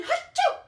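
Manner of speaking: giant acted sneeze, loud and high; huge sneeze. Wolf sneezing the straw house down, huge loud sneeze. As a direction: blast out a theatrical achoo.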